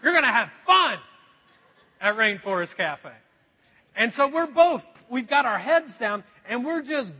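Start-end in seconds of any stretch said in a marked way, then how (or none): 0.0-3.1 loud mock scolding of the kids; mock scolding parents. Parents telling cold kids to keep walking, loud mock scolding. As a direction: bark it like a fed-up parent, half joking. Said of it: "We're like".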